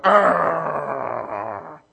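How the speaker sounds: mock dog growl with teeth bared. Pastor growling at his dog, mock growl. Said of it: "I showed my teeth and I went".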